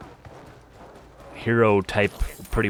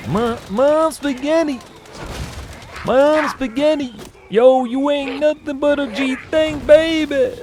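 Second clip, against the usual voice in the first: very high, nasal rap mimicry; nasal rapper voice; slower than usual. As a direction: push it high through the nose like a rapper. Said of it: "Saying through his nose".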